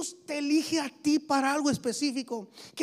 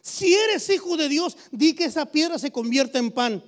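louder preaching with eres stressed; 7 dB louder than usual. Acted. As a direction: challenge them forcefully. Preaching at full force. (predicando con fuerza)

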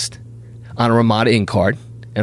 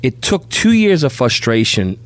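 ordinary talk

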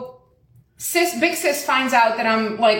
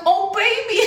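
very high cooing "poor you" voice; imitated gushing sympathy; 10 semitones higher than usual. Lady to customer who is PMSing, imitated gushing sympathy. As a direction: gush in sympathy, half laughing.

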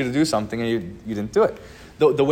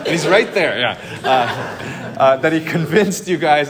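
laughing; 3 semitones higher, 7 dB louder than usual. Real, not acted. Pastor laughs at imagined scene.

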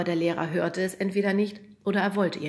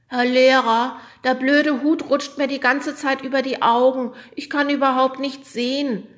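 complaining; 8 semitones higher, 9 dB louder than usual. A narrator's reading. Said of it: "beschwerte sich Mika".

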